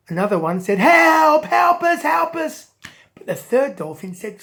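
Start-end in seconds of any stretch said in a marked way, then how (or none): 0.8-2.7 high, loud plea for help; crying for help. Acted. Dolphin begging the King of the Fish, a high loud cry for help. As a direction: cry out for rescue.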